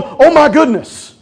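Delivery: high, loud, dismayed devil voice; hammed-up alarmed Satan; panicked enemy. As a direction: play a rattled, beaten enemy. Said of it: "the enemy's standing there going".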